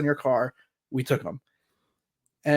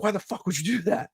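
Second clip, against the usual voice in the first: imitated pissed-off dad; 7 semitones higher, 3 dB quieter, faster than usual. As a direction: mimic an exasperated father in disbelief. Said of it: "He was so pissed at me".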